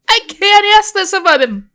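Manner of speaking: loud, high, angry bark of a refusal. Acted. Jill barks at her friend, a loud high refusal. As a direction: snap it back hard, standing up. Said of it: "I barked".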